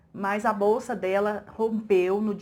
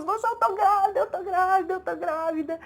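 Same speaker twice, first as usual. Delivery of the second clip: ecstatic announcing; 8 semitones higher than usual. Retold or imitated. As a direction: imitate her overjoyed news. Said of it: "ela naquele êxtase todo". (anunciando em êxtase, mais agudo)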